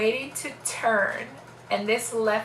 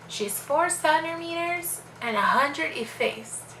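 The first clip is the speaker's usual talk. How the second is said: higher, slower announcing voice; measured, high-pitched retold report; nurse calling out; 7 semitones higher, slower than usual. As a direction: imitate a nurse calling out a status.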